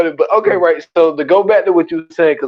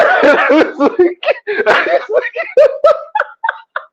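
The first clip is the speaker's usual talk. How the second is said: high shrieking laugh. Real, not acted. Host losing it at his own punchline, howling laugh. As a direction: howl with laughter.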